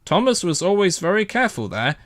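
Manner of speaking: ordinary delivery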